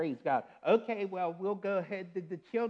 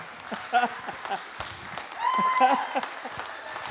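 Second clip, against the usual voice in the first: tearful squealing yes; 4 dB louder than usual. Real, not acted. Proposal accepted, overwhelmed.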